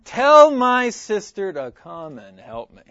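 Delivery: loud, slow, bossy demand; commanding. Retold or imitated. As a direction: order him to make her sister help, loudly and slowly. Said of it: "Martha actually commands Jesus".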